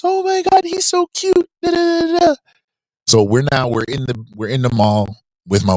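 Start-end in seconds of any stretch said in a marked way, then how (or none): 0.0-2.3 put-on high gushing baby coo; a hint of a cooing stranger. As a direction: gush over a cute baby, way up high.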